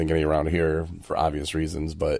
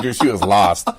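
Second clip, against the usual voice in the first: normal talking voice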